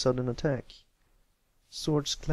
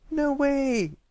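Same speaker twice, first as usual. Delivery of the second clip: much higher, loud anxious cry; anxious shout; 14 semitones higher, 6 dB louder than usual. A narrator's reading. Pipsqueak interrupting the story, anxious shout. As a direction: blurt it out in alarm. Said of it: "Pipsqueak shouted anxiously".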